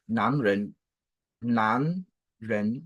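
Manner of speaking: "nan, ren" each gliding upward; rising pair of syllables, "nan, ren"; second tone. Shown as a demonstration. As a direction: lift both "nan" and "ren" from low. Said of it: "est le deuxième ton".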